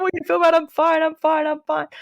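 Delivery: reassuring the partner in a high teary voice; high, teary reassurance. Retold or imitated. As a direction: brush it off while still crying. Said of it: "And I'm just".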